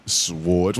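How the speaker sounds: SWORDS with the W sounded, low and quizzical; mimicked pronunciation. Friend questioning the other's odd pronunciation, mimicking it. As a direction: echo SWORDS back softly, W pronounced. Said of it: "Why do you pronounce it like that?".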